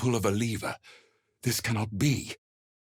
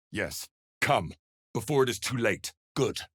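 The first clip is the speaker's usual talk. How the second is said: ordinary talk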